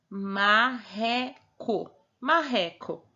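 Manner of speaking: very slow, drawn-out reading; slow spelling-out. Shown as a demonstration. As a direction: read marreco slowly so children hear the rr as h. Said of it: "tem o R no começo, tem o R no meio".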